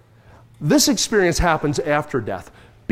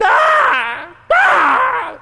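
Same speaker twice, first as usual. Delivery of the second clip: piercing high imitated squawk; loud, harsh raven screech; 4 dB louder than usual. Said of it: "heard a raven squawk".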